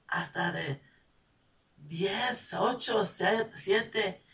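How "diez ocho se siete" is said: usual speaking voice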